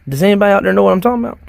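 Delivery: quick loud plea to viewers; loud fast appeal. Real, not acted. Calling out to viewers.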